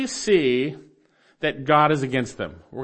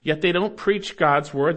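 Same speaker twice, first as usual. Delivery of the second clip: normal talking voice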